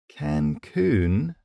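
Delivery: questioning, unsure echo; uncertain. A narrator's reading. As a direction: repeat it doubtfully. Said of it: "I repeated uncertainly".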